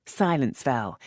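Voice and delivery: plain reading voice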